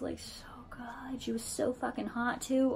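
soft, breathy swoon; swooning whisper. Real, not acted. Remembering her summer girlfriend, swooning in a whisper. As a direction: drop to a whisper, savoring the memory. Swooning privately over the memory.

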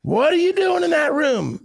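very high mock yell of an angry wife; high-pitched nagging wife impression. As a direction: screech it like a wife from another room. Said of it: "the wife's yelling".

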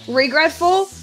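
normal talking voice

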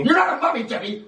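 high-pitched scolding; exasperated. Acted. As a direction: correct the child in a high, fed-up voice. Retake, exasperated with child.

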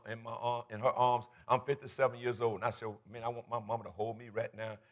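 low, very soft wistful murmur; quietly aching, recalling a private wish; retold longing. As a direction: voice dropped as if confiding grief. Recalls longing for dead parents.

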